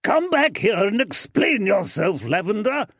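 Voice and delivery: high angry yell. Acted. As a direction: bellow after them. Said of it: "Tobias yelled after them".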